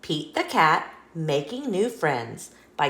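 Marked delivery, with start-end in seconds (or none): none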